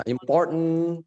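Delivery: "important" with the t stopped, straight into n; held-back t. Shown as a demonstration. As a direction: hold the t in "important", release only through n. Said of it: "langsung ditahan".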